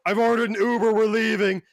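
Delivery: put-on loud, high drunken declaration; drunken announcement. Drunk friend telling the group he's leaving, loud and high. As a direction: announce it loudly to the whole bar, a bit too high.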